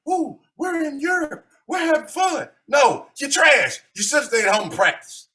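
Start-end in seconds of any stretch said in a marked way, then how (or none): 0.1-2.5 mock tourist. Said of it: "fly over there to get the atmosphere".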